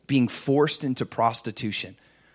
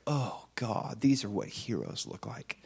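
much softer, lower, awed inner voice; awed hush; 4 semitones lower, 11 dB quieter than usual. Retold or imitated. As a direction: breathe it out in quiet awe. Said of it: "my heart was just like".

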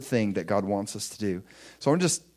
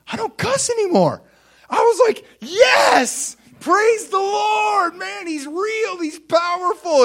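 very high, loud, crying-out joy; overjoyed cry of praise; ecstatic shout; 7 dB louder, slower than usual. Real, not acted. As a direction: shout it out in sheer delight. Joyful realization shouted.

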